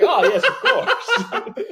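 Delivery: very high, slow falsetto with laughter; laughing falsetto yes; mock posh agreement. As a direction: squeak out a prim yes through a laugh. Mock reply of the listeners.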